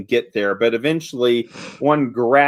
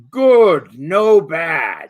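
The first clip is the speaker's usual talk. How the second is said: giantess broken speech; 3 semitones higher, 5 dB louder, much slower than usual. Acted. Giantess vouches for her companion, loud and eager. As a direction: call it out like a friendly giant. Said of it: "Points at the one next to her".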